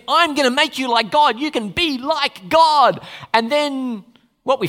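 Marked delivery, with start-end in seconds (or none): 0.1-3.1 louder, higher tempter's pitch; tempting devil. Retold or imitated. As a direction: sell it like a smooth devil. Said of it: "So he says".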